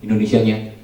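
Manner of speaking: ordinary talk, nothing marked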